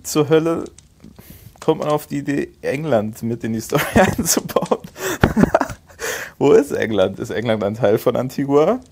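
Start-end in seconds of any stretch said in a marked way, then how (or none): 4.8-5.7 high squeaky laugh. Real, not acted. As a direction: burst out laughing in disbelief. Laughs at story's geography.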